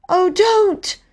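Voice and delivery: put-on very high, pleading girl's cry. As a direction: beg him to stop. Bobby pleads with station master.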